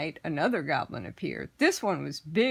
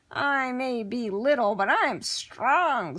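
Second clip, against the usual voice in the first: lightly voiced goblin; 6 semitones higher, 3 dB louder, slower than usual. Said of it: "said the goblin".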